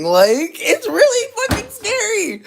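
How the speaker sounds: loud, very high squeal of fear; high-pitched, frightened outburst; terrified. Real, not acted. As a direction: let the voice shoot up into a real panicked squeal. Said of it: "I'm actually terrified right now".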